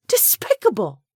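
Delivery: high loud scornful cry; disgusted shout. A narrator's reading. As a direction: hurl the word at him. She condemns him.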